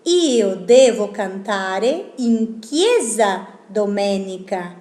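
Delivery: Io devo cantare in chiesa domenica, slow and careful; slow Italian sentence. Shown as a demonstration. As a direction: read the Italian again slowly. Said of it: "Por ejemplo". (la frase en italiano, lenta y clara)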